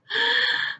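quick high intake of breath; wolf drawing breath to huff; sharp inhale. A narrator's reading. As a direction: suck in air to blow.

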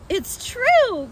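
put-on high, loud child-like cry; excited small foxes. A narrator's reading. Small foxes assuring Badger, excited high cry. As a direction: squeal it out like eager cubs. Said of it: "cried the small foxes".

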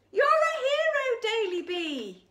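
high, loud joyful cheer. Acted. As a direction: shout it in celebration. Rabbits cheer the hero.

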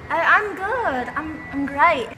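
chirpy fake British accent. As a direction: pretend to be British, brightly projected. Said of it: "She's from Britain".

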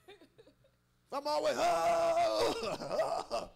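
breaking into a laugh. Real, not acted. Laughing about yelling.

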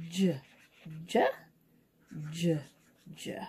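"J" as a short "juh", each one separate; letter sound. Shown as a demonstration. Teacher searching the page for J's, each "J" sounded out as juh. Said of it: "sound out this letter J sound".

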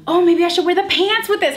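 breathy gasp, then bright idea; gasp of sudden idea. Real, not acted. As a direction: gasp as it suddenly hits you.

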